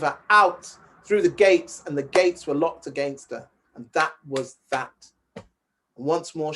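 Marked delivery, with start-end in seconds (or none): none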